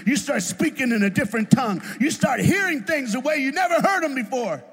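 loud, high preacher's cry; shouting the sermon, pitch way up; full-force preaching. Acted. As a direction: preach it out to the congregation.

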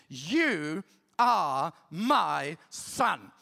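low, very slow solemn divine voice; voice of God. Acted. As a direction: speak each word as God, weighty and drawn out. God speaking to Jesus, slowed.

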